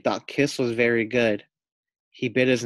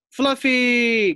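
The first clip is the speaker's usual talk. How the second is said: high, louder stretched call; 8 semitones higher, 6 dB louder than usual. Acted. Teacher calls the pig onto the stage, calling out. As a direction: summon him to the stage. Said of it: "called Mister Small".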